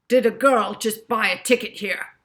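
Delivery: angry villain demanding answers; aggressive. A narrator's reading. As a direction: bark the question at the clerk. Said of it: "he asked aggressively".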